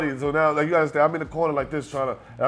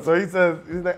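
ordinary talk, nothing marked

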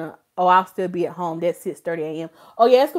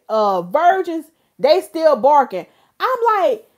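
ordinary delivery

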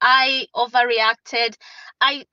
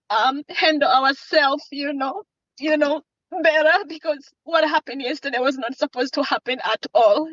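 usual speaking voice

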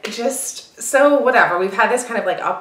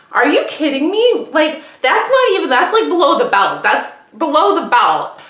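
high, indignant protest at a louder level; retold outrage; 8 semitones higher, 4 dB louder than usual. Retelling her comeback to a friend's insult, indignant. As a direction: replay the outrage, pitched up and pushed.

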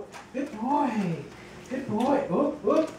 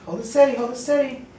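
ordinary talk

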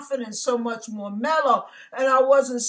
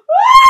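very high, very loud howling scream; full-force howl; 14 dB louder than usual. Retold or imitated. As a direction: howl loud enough to bust the speakers. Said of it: "I sounded like this".

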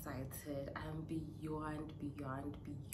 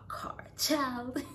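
teary cry; 8 semitones higher, 6 dB louder than usual. Real, not acted. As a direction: let joy break into tears. Tearful joy.

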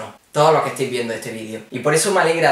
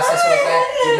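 high laughing; slower than usual. Acted.

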